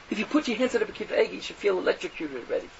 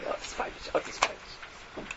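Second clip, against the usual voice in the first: mock whisper; 11 dB quieter than usual. Mock awe at five-ish sign.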